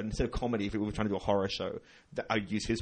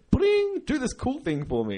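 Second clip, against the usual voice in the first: mock chirpy ping; 4 dB louder, slower than usual. Retells message arriving.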